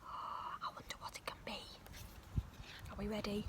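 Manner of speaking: plain reading voice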